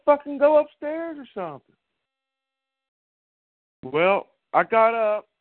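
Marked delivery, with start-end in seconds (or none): 0.1-1.7 higher, mock yell up the stairs; retold snap at the wife, higher pitched. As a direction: bark it back at her, half laughing. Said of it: "I said".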